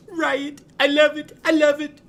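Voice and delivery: very high, very loud shrieking joy; mouse cheering. Acted. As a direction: shriek with delight like a tiny fan. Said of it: "Ralphie's there going".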